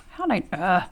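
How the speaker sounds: high exasperated seriously; annoyed disbelief at a mistake. Real, not acted.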